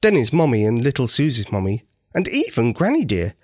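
ordinary delivery

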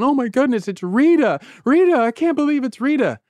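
high-pitched scandalized onlookers; aghast disbelief. Acted. Townspeople watch Rita walked out, aghast disbelief. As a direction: gasp it out high, in mock shock.

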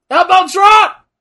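loud, high hype shout; excited shout. Real, not acted. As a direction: call out the move with glee. Excited call of wrestling move.

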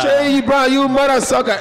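high, loud mock insults; catchphrase shout. Comic re-enacting his stage catchphrase, high mock-abusive shout. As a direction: hurl it at the crowd, high and loud. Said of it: "I would go on stage and be like".

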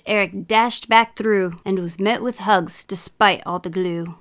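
story read in a bad British accent; hammy British accent, lively storytelling; put-on British accent. Mum reading a children's story for laughs, put-on British accent. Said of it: "throw a little accent in there".

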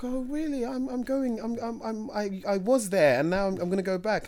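mock high-pitched shop girl, laughing; high girl's voice. Retold till girl answering him, high and giggly. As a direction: squeak it out like the excited till girl. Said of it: "she was like".

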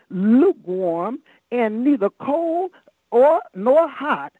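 drawn out slowly, each word weighed; slow down and lean on lukewarm like a warning; slow preached emphasis. Acted. Preacher stresses scripture word.

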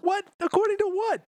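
high, loud disbelieving shout; shocked outburst; outraged surprise. Real, not acted. Player shocked at losing.

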